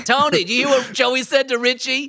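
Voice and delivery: high, hammed-up New York gossip; spreading neighbourhood gossip in a thick New York accent. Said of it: "the heavy New York accent".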